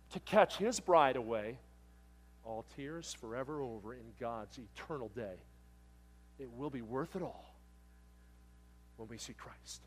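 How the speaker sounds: slow, soft and reverent; the preacher reciting a hymn to the congregation, reverent and slow; hymn recitation. Acted. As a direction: recite it like a prayer.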